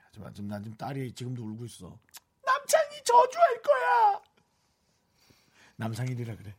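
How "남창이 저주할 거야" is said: daughter's voice. Retold or imitated. Daughter's crying curse quoted.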